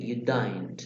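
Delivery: "You don't" in a British accent, the vowel of "don't" said like "daint". Shown as a demonstration. Teacher demonstrating a British accent for students. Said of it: "los británicos suelen decir".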